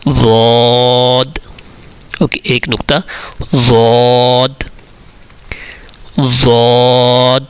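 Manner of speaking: ضاد held very long, tongue side against the molars; slow careful recitation. Shown as a demonstration. Teacher recites ضاد slowly to show its articulation. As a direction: press the tongue edge to the left molars for ضاد. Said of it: "ضاد کا جو مخرج ہے".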